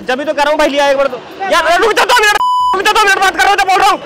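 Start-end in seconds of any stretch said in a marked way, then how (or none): 1.5-4.0 calling out to wait. Acted. Shouting at friend mid-prank.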